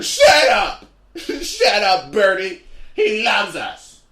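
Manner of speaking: high, loud, shrill fan squeal; indignant fan. Retold or imitated. Fan scolding the comic over Harry Potter, in a high loud squeal. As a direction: shriek at him like an offended superfan.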